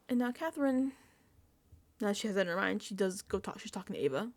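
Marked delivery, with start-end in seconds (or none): none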